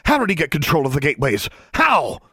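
furious shout. A narrator's reading. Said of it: "she shouted into the girl's face".

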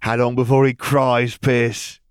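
high, loud mocking bully voice; taunting bully. A narrator's reading. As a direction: sneer it down at the new kid. Dudley taunts again.

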